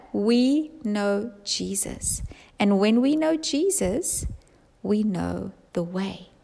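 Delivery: slow, hushed emphasis; slow and soft. Real, not acted. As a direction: let each phrase land slowly. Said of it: "slowed, hushed emphasis".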